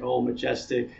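normal talking voice

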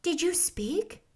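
high, slow wondering question. A narrator's reading. Girl asking the scarecrow if it spoke, wondering. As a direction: ask in soft wonder. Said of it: "asked the girl in wonder".

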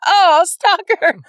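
slow, loud, stretched oh, stalker; drawn-out mock accusation. To her cohost about a creepy guy, slow drawn-out mock accusation. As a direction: drag it out like a teasing accusation. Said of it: "you're like".

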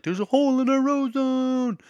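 high, dramatic alarmed voice; alarmed mock voice. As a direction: play the panicked doomsayer. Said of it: "people being like".

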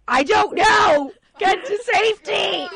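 high, loud, desperate shout; panicked shout. Retold or imitated. Mother telling her sister to flee with the child, panicked high shout. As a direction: yell it at her in a near-cry panic.

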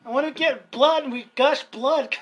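high, loud hammed-up lament; exaggerated playful grievance; mock complaining. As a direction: play the picked-on victim for laughs. Clowning as the picked-on redhead.

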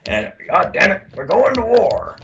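high, strained growl of a cigar-chewing officer; imitating old commander. Cigar-chewing commander announcing war to his troops, hammed-up growl. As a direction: ham up the crusty old lifer through clenched cigar. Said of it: "And he'd talk like this".

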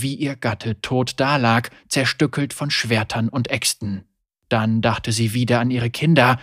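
ordinary delivery

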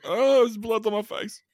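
very high, slow mock wail; mock screaming and crying. Mimicking the film's blood-covered man, mock crying. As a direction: whine it like a hammed-up panicked victim. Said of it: "he's, like, screaming and crying".

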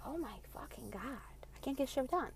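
whispered, fed-up aside; exasperated muttering. Retold or imitated. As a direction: mutter it under your breath in despair. Exasperated reaction to screaming mom.

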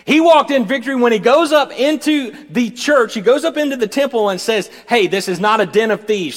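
high, loud preaching; loud, rising pulpit proclamation; full-force preaching. Real, not acted. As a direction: drive the sermon home at full voice.

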